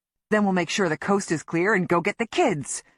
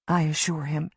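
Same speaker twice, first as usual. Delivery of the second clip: usual speaking voice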